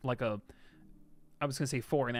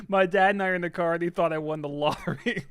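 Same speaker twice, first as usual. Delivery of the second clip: higher, louder, breaking into giggles; laughing while talking; 4 semitones higher, 5 dB louder than usual. Real, not acted. Streamer reading a viewer's comment, laughing through it. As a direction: read it out through real laughter.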